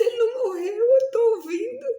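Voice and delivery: high, tearful half-laugh of relief; laughing through tears. Retold or imitated. Mother realising her son lives, laughing through tears. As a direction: cry and laugh at once, he's alive.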